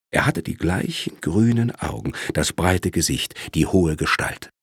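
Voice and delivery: ordinary delivery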